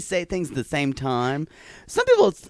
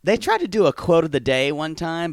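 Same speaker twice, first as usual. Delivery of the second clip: ordinary talk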